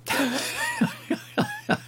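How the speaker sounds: high burst of laughter; laughing. Real, not acted. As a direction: laugh at your own joke. Laughs at own Kenneth Anger guess.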